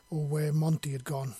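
plain reading voice

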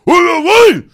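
Satan-like roar. Retold or imitated. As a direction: roar like a furious big man. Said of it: "uma voz de Satanás". (marido furioso gritando para segurar o oficial, berro enorme)

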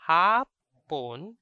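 hapon with a long, loud first "a"; long vowel. Shown as a demonstration. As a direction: lean hard on the "a" of hapon and hold it. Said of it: "Different from the long vowel".

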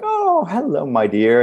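put-on high-pitched old lady greeting; ancient lady. Front-desk lady greeting the student, ancient lady. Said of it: "this lady then, on the second day".

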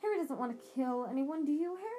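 anxiously pressing Harry for a no; panicky. A narrator's reading. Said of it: "in a panicky tone".

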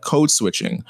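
usual speaking voice

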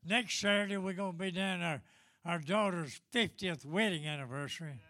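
ordinary talk, nothing marked

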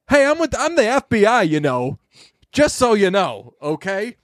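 badge-flashing agent. FBI agent telling everybody who he works for, showing off. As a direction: act out an agent flaunting his job. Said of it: "being like".

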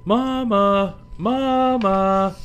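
high, loud call for Mama. Acted. Cub calling loudly for her lost mama. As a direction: call out to find her mother.

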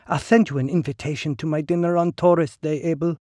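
high, silky and knowing; smooth woman's voice. Acted. Ligny character voice.

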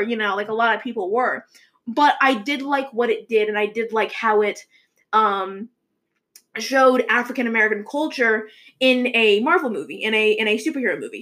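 ordinary talk, nothing marked

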